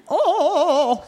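high, drawn-out wavering "oh"; Sarah Palin. Acted. Repeating the one-word micro impression, high warbled mimicry. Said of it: "That's a good micro impression, Sarah Palin".

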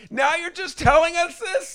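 loud, high, drawn-out incredulity; outraged disbelief. Retold or imitated. Retelling a reaction to late news, outraged yell.

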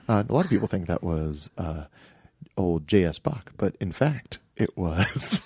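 lightly imitated classical DJ, low and measured; classical radio host. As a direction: pause knowingly, then let a chuckle creep in. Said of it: "they say jokes that you wish you could get".

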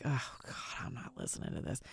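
dismissive whispered aside, mocking listeners. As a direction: breathe it out as a bored listener tuning out. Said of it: "Some of you guys are like".

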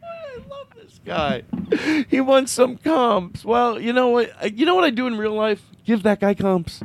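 high, squeaky falsetto gushing; gushing delight. Pretend. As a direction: gush in a very high, tickled voice. Gushing over request for comps.

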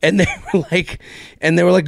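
ordinary talk, nothing marked

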